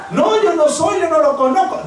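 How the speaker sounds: quick high panicky denial; Peter's denial. Retold or imitated. Pedro, accused by a servant, denies knowing Jesus: high panicky denial. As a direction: deny it fast and high, caught out.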